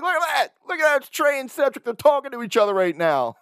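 high-pitched excited fan; fired up. Retold or imitated. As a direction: squeal it like a thrilled fan pointing out favorite players. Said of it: "gets me fired up".

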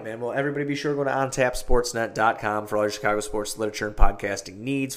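normal talking voice

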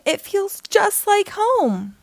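high and slow, savoring every word; glowing delight, drawn out; joyful exclaim. Acted. Homesick V finally feels at home in snow, delighted.

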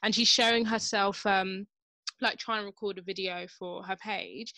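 usual speaking voice

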